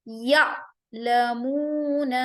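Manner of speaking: یا لمون with a sharp glottal catch on the alif; Qur'anic hamza with a hard stop; hamza with a jolt. Shown as a demonstration. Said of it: "ہم الف کے اوپر آواز کو سختی سے جھٹکا دیں گے".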